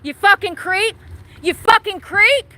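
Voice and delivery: real angry yell. Real, not acted. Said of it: "I'm out here screaming".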